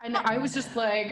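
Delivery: ordinary talk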